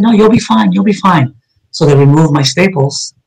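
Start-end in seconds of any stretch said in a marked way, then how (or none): 0.0-1.3 lightly mimicked nurse, higher, brushing it off; a hint of a chirpy nurse waving away worry; breezy nurse.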